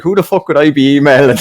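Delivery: high, loud incredulous outburst; mock indignant. Customer scoffing at salesman's request for email, mock indignant. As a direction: play up the customer's outrage for laughs. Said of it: "he must have ripped into the poor young lad".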